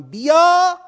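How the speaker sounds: loud slow summons; calling out. Acted. Preacher voicing God inviting people to follow Him, loud and slow. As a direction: call them to come along, drawn out.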